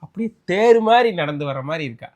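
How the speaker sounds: husband teasing his wife by raving about the other woman; mock gushing praise. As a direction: lay the praise on thick as a joke. Husband gushing mockingly over photo.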